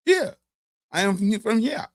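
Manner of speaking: high squeaky dodge of the question; mocking a nervous, evasive answer; mock evasive squeak. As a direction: pitch it way up like you're hiding something. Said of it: "I'll be like".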